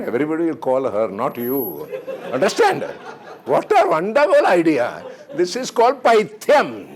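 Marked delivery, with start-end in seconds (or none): 2.3-6.9 high laughing delivery. Real, not acted. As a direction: laugh through the words. Laughing at crazy idea.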